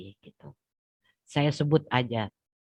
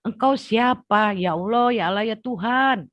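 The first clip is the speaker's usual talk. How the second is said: crying out to God; 11 semitones higher, 6 dB louder than usual. Retold or imitated. Locked in her room calling on God, high weeping cry. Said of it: "Itu aja yang saya sebut".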